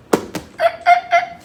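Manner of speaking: a sudden squealing cackle, well above the voice; high shrieking laugh. Real, not acted. Teller shrieking with laughter at own story.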